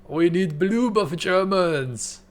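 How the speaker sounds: drawn-out vampire plea for "bloob"; German vampire saying bloob. Shown as a demonstration. Streamer playing a German vampire craving blood, mock pleading. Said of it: "they make a mistake and they say bloob".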